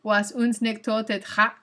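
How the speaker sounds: slow, careful German pronunciation; attempted German accent. Shown as a demonstration. Streamer trying again for chat, in a careful German accent. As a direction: build up to a German rolled R. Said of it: "I have to roll my R".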